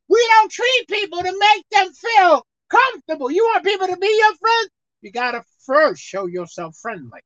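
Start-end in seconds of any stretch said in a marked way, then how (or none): 0.1-4.7 building sermon delivery; preaching. Acted. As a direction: preach it to the congregation. Preaching shout.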